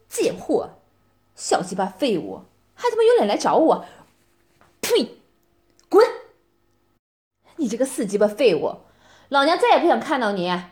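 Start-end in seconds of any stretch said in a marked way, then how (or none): 4.8-5.2 sharp high spitting sound; a scornful "pah!"; contemptuous spit. Acted. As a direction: spit at him in disgust. Spitting at groveling ex.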